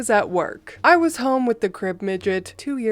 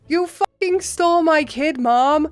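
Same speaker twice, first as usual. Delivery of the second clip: higher, hard angry snap on stole; angry accusation; 6 semitones higher, 3 dB louder than usual. Retold or imitated. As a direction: tear into mom over the stolen kid. Yelling at his mother.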